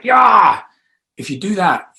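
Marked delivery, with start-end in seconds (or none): 0.0-0.6 high, loud cheer; loud high "Yeah" of triumph; excited yeah. Shown as a demonstration. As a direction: whoop it out. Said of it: "just kind of like".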